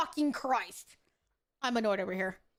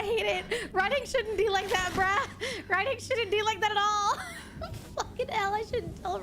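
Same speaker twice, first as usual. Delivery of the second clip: laughing in exasperation; 8 semitones higher than usual. Real, not acted. Exasperated at the game's writing.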